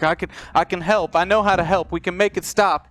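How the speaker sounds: loud, high, frantic plea; desperate pleading. Acted. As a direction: plead wildly to be heard. GM voices desperate attacker pleading.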